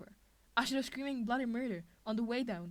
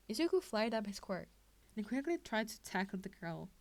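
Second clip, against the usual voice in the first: ordinary delivery, nothing marked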